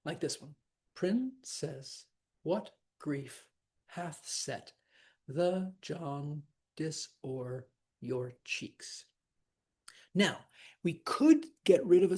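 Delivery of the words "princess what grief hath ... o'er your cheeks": slow, measured verse, broken into small pieces; counting out syllables. Shown as a demonstration. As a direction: recite it beat by beat with pauses. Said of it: "But sometimes there's twelve".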